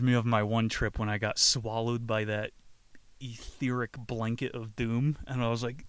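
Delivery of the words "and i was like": usual speaking voice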